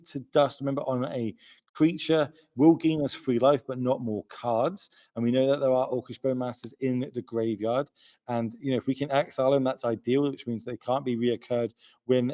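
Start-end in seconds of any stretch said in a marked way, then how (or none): none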